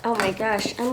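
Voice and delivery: ordinary talk, nothing marked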